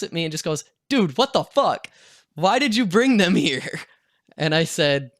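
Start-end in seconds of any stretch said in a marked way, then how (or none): 0.9-3.8 higher and louder, an exasperated yell. Retold or imitated. Friend yelling at him for bringing the girls over, louder and exasperated. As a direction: let him have it, dude.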